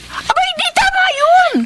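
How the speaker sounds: indignant outburst. Acted. Lily reacts to John rejecting the baby, outraged. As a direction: spit out the indignation.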